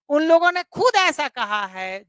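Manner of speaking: खुद given the main weight; emphatic stress. Shown as a demonstration. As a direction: insist they said it themselves, hitting खुद. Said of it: "जोर देके कह रहा है".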